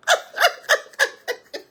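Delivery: high, breathy burst of laughter; airy laugh. Real, not acted. Vlogger laughing after her advice, high and airy. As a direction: laugh off the point.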